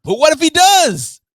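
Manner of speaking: high, loud burst; excited challenge. Real, not acted. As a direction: throw the objection out in a high-pitched yelp. Pushback on a confident prediction.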